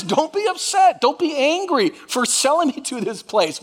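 high, gentle reassurance; gracious. Acted. Joseph reassuring his guilty brothers, gracious.

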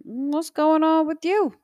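put-on high, teasing kid voice; imitating her kids. Kids asking their moody mom what's going on, in a high teasing child voice. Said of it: "my kids will say".

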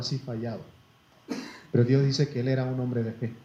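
normal talking voice

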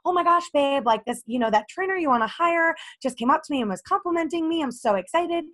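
high, thrilled phone-call gush; excited gushing. Retold or imitated. Girlfriend calling boyfriend after trainer's praise, excited and high.

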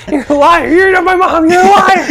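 very high, loud mock outrage; hammed-up accusation. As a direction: squawk it accusingly, half in play. Acting out the accusing cleaner.

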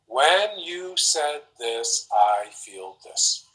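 very slow, every word laid out; slow deliberate I-statement to the partner. Shown as a demonstration. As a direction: spell out the I-statement slowly. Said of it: "I want you to hear what I'm saying".

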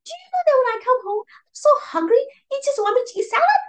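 high, shrill-free furious rant; angry outburst. Retold or imitated. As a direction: yell it at your sister. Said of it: "I was so angry".

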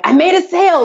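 high, bright announcement; excited. Retold or imitated. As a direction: proudly announce the news. Mom announcing sale to kids.

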